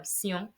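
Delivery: "sillon" as soft see-on, nasal end; French -tion sound. Shown as a demonstration. Teacher correcting herself for learners, quiet "sillon" as see-on. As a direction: quietly model "sillon" as s-yon. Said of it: "is like C and on".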